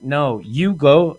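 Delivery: "No, you go" drawn out very slowly; slow defiant retort. Retold or imitated. Defying the security guard outside the washroom. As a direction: stretch each word out to defy him.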